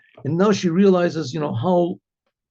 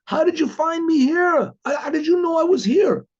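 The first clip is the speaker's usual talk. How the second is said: high-pitched calling out, acted astonishment; retold yell; 11 semitones higher than usual. Card player asks wife how she found him, retold yell. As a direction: cry it out high, as if across a noisy bar. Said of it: "yells out".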